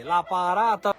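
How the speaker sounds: ordinary talk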